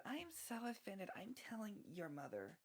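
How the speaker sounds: high feminine prank voice; put-on feminine voice. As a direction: play an offended woman to prank someone. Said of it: "switch into a more feminine voice".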